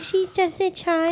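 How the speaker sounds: high, drawn-out mock defence; mocking imitation. Imitating the co-host defending a character, mocking.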